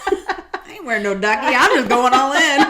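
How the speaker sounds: loud, high, laughing boast; hammed-up daredevil declaration; playful bravado. Friend refusing the ducky before diving in, loud comic bravado. Clowning about diving in all-in.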